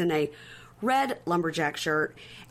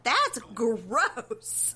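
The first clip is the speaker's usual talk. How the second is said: mimicked disgust; 4 semitones higher than usual. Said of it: "they were like".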